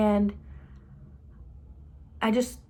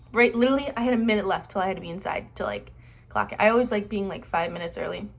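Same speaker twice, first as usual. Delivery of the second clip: usual speaking voice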